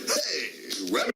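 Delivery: usual speaking voice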